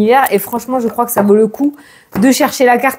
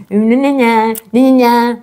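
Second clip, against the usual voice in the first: mocking gibberish; slower than usual. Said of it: "quand j'ai fait la petite voix".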